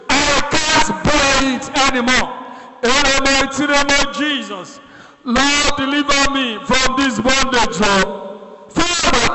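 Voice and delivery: high, earnest cry to God; fervent call for deliverance; pleading prayer. Shown as a demonstration. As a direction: plead with God as if at the end of your strength. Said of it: "Open your mouth, talk to God".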